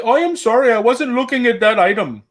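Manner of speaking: high, sugary sarcastic apology; overly sweet mock-polite voice; fake polite apology. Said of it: "I'll just bust out and say".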